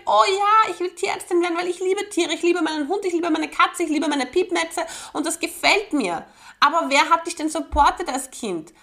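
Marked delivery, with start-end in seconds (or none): 0.0-6.2 put-on higher, eager child voice; excited little girl. Child gushing to herself about becoming a vet. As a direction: gush like a child about her pets. Child voicing love of animals.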